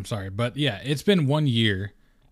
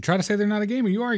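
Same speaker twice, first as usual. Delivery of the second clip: emphatic high; 9 semitones higher, much faster than usual. Real, not acted. Emphatic insistence.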